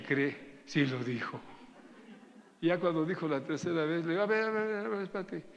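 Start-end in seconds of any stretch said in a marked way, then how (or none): 4.2-5.5 high-pitched, hurried stop-her interjection; interrumpting retort. Retold or imitated. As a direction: cut in with a much higher voice, retelling it. Cortando a la mujer al relatarlo.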